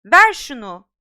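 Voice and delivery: loud furious shout; Harry shouting at Malfoy. Acted. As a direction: demand it back at full voice. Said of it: "diye bağırdı Harry".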